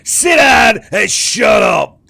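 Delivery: furious high, drawn-out bellow; emboldened guard shouting. Acted. Guard ran back to threaten the prisoner, furious shout. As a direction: roar threats through the cell door. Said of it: "his balls have just got bigger again".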